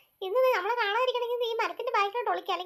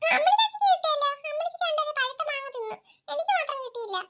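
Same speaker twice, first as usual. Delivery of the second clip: crying complaint; 6 semitones higher than usual. Acted. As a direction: sob out the unfairness to grandma. Boy crying to grandmother. (മുത്തശ്ശിയോട് കരഞ്ഞ് പരാതി)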